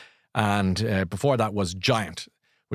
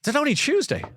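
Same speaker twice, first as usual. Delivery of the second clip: surprised realisation; faster than usual. Real, not acted. Host realises the weekday.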